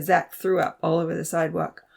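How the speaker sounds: ordinary delivery, nothing marked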